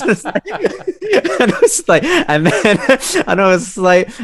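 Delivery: breaking into high laughter mid-sentence; can't get the words out for laughing. Real, not acted. Telling the coming-out story, helpless laughter. Laughing too hard to continue.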